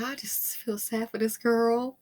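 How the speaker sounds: usual speaking voice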